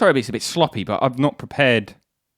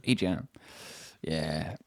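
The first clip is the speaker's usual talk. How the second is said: low, hushed smug delivery; 9 semitones lower, 13 dB quieter, much slower than usual. Pretend. Friend trying out the jam catchphrase, smugly. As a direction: say it softly, pleased with yourself. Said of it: "say it in a smug way".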